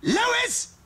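high call of "Lois!". Acted. Stewie calls out to get Lois's attention. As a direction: call across the house.

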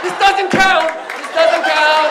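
high, loud, indignant whine; mock protesting groundhog. Groundhog stuck in the blizzard, protesting in a high whine. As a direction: protest it like a sore loser. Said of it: "He's like".

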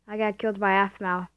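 usual speaking voice

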